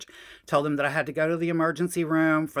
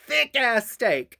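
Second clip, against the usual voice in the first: "thick-ass" drawn out slowly, higher and louder; stress on thick-ass; 9 semitones higher, 6 dB louder, slower than usual. Retold or imitated. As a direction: lean hard and slow on "thick-ass". Insisting on a really thick steak.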